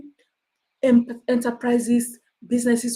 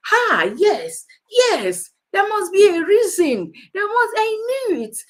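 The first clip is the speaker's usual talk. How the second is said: gloating commenter; 9 semitones higher than usual. Retold or imitated. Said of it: "I'll just tell you what the guy said".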